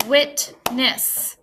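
"Witness" broken into wit and ness; "Witness" with a clear gap between syllables; split into syllables. Shown as a demonstration. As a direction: clap out "Witness" in two beats. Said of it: "I counted two claps".

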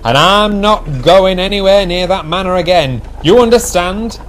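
firm. A narrator's reading. Driver refuses the fat controller, firmly. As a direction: refuse loudly and demand agreement. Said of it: "said the driver firmly".

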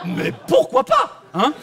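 loud, very high, slow outburst; hammed-up acceptance. Passenger reacting to a woman pilot, overdone high outburst.

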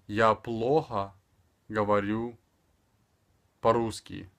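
"Я плохо говорю по-русски" in slow chunks with long pauses; spaced-out teaching delivery; extremely slow. Shown as a demonstration. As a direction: leave room after each word for repeating. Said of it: "speaking extremely slower".

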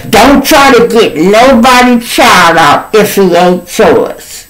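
mimicking her loud, high-pitched scolding; loud high imitation of a woman laying down the law; going hard. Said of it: "she going hard with it".

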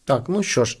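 normal talking voice

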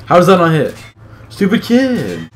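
high, loud gamer frustration; exasperated outburst. Real, not acted. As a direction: yell it in real exasperation. Player rages at missed shot.

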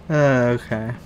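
usual speaking voice

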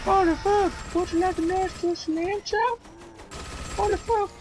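high-pitched alarm. Pretend. Gamer reacting to zombies, high squeal.

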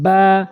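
"বা" drawn out level from closed lips; lips-together articulation. Shown as a demonstration. Teacher shows learners a letter, long "বা" from the lips. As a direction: press the wet lips, release a long "বা". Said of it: "দুই ঠোঁটের ভেজা অংশ মিলাবেন".

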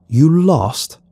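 put-on an old man calling to a stranger; lightly voiced old farmer calling out. A narrator's reading. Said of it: "he cried out".

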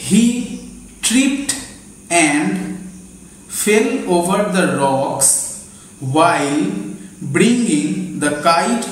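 very slow, word by word with pauses; slow classroom dictation. Shown as a demonstration. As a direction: dictate so they can write it down. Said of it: "আমি আস্তে আস্তে বলছি".

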